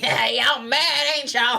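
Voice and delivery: high, very slow goading imitation; hammed-up teasing taunt; mock taunting. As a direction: stretch it out high to needle them. Mocking imitation of creator's taunting apology.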